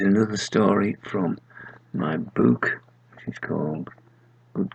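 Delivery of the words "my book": ordinary delivery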